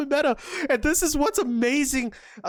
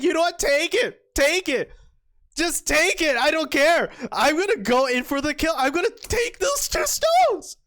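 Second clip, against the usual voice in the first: high, excited mock defiance; hammed-up bravado rising higher; defiant player imitation; 6 semitones higher than usual. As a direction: act out a reckless player, building to a squeal. Voices player's defiant thinking.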